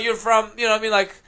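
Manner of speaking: normal talking voice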